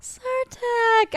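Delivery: Sartak cried out very high; excited shout. Real, not acted. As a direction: squeal the name in delight. Cheering a missed character.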